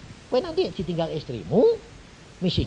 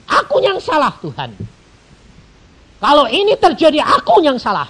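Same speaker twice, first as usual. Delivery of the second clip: loud confession; 11 semitones higher, 11 dB louder than usual. Acted. Acted confession prayer.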